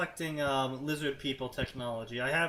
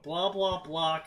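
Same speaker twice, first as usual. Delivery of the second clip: ordinary talk, nothing marked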